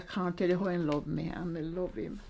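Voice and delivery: ordinary talk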